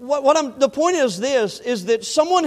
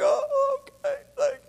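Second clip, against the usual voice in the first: crying; 5 dB quieter than usual. Retold or imitated. Pastor thanking the caller for the gift, tearfully crying. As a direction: voice cracking high with tears, thanking through a sob. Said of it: "I started crying".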